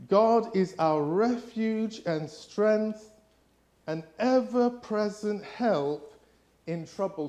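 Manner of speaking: slow solemn scripture reading. Acted. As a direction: read it out slowly like scripture. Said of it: "it says this".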